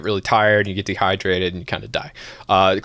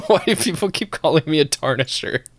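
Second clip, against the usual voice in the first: higher, laughing bewilderment; baffled; 6 semitones higher than usual. Real, not acted. Host puzzled at chat calling him tarnisher, laughing confusion. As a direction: ask it genuinely confused, half laughing.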